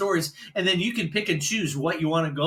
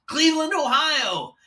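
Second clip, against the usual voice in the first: much higher, upbeat radio patter; disc jockey voice; 9 semitones higher than usual. Retold or imitated. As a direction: sell it like a morning zoo DJ. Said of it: "disc jockey sounding voice".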